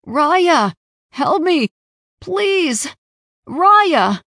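high, loud pleading cries; desperate cries for help. A narrator's reading. Trapped sibling calling Raya for help, desperate shouts. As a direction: cry out in panic for rescue.

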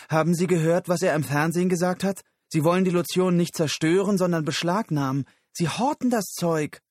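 high, loud, fast indignant tirade; boiling with rage. Acted. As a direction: rant furiously, racing through it. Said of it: "Susan kochte vor Wut". (aufgebrachte, wütende Tirade)

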